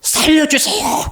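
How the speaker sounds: high, slow crying plea for help; wailing plea. Retold or imitated. Widow begging the prophet to save her, high slow wail. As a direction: voice a widow begging through tears. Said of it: "어떻게 울겠어요?".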